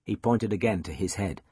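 plain reading voice

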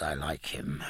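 breathless whispered yes; hoarse whisper. Acted. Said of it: "he said hoarsely".

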